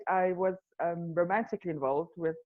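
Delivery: ordinary delivery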